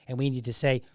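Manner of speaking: ordinary talk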